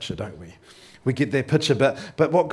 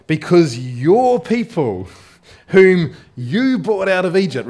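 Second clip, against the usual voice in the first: slow, loud, raised voice of an angry God; 6 semitones higher, 9 dB louder, slower than usual. Acted. Preacher voicing God's anger at the people. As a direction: boom it out as God rebuking.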